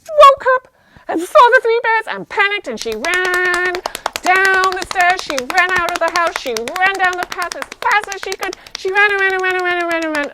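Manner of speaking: loud, high, racing storytelling; urgent storyteller at full tilt; panicked chase narration. A narrator's reading. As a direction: tumble through it breathlessly fast and high. Narrating Goldilocks' panicked escape.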